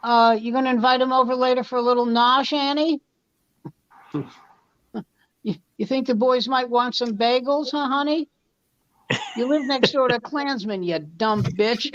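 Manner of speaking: hammed-up faux-polite sarcasm; sarcastic jab. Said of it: "he looks at her and goes".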